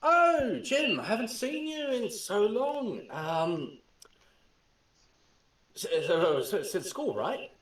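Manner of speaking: high, nervous British chatter with stammers; jittery British voice. Acted. Nervous British character greets old schoolmate Jim, jittery British voice. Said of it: "with a British voice".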